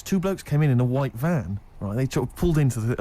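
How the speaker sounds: ordinary talk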